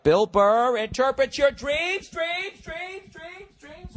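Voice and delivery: very high, shouted announcer voice fading into echoes; echoing announcer jingle. Pretend. Host announcing his new dream segment to listeners, mock echo jingle. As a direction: announce it like a cheesy radio segment with reverb. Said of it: "will be a new segment".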